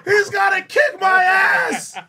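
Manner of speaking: high, loud yelling through laughter; laughing shout. Real, not acted. Joking that Ice Poseidon is coming for him, laughing shout. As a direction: shout it while cracking up. Laughing about being hunted.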